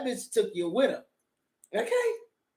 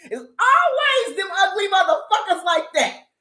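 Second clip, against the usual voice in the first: ordinary talk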